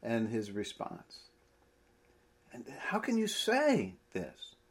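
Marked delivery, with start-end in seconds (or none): 2.5-4.6 whispered, pressing and incredulous; exasperated whisper. Acted. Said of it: "trying to show the mood of his questioning".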